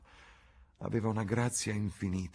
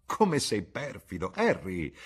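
exclaiming; 2 semitones higher, 4 dB louder, slower than usual. A narrator's reading. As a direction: exclaim it at the friend, drawing it out. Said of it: "Esclamò la duchessa". (esclamazione acuta e strascicata)

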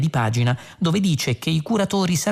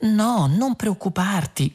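high, slow singsong reassurance; overdone sweet lilt; trilling; 7 semitones higher, slower than usual. A narrator's reading. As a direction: warble soothingly, drawn out. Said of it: "gorgheggiò ancora Trillian".

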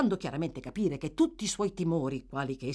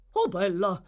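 più alto e più forte, stupito; esclamazione sorpresa; 9 semitones higher, 7 dB louder than usual. Acted. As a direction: esclama incredulo riconoscendo il nome. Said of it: "esclamò il signor Bumble". (Bumble esclama sorpreso, voce alta)

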